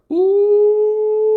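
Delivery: very high, long, loud ooh; teasing "ooh". Retold or imitated. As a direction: squeal a drawn-out, scandalized ooh. Teasing reaction to racy story.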